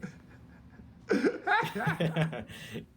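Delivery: loud high-pitched laugh. Real, not acted. Laughing at emo cake.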